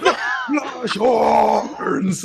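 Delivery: Minotaur battle cry. Acted. Minotaur shoving Siren aside, roaring for a headbutt. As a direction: yell it as a challenge. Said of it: "going like".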